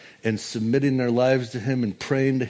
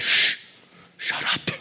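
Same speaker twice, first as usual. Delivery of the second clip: hushing whisper. Retold or imitated. Peter voiced hushing the crowd.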